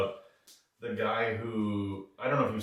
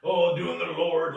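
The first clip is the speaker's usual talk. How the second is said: higher, slow sanctimonious tone; mock pious; 7 semitones higher, 4 dB louder, slower than usual. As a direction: sneer at fake piety. Mocking born-again killer.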